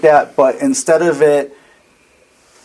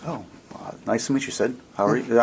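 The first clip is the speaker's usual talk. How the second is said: hushed NPC reply; 9 dB quieter, faster than usual. Acted. GM voices the robed man.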